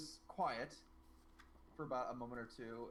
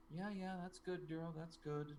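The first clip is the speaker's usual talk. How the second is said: distracted mom; 3 semitones higher than usual. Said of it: "her mom's like".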